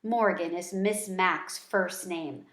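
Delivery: usual speaking voice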